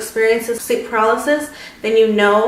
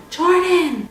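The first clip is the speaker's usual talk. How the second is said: retold scream; 8 semitones higher than usual. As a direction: cry the name out high, holding back volume. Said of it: "I literally screamed".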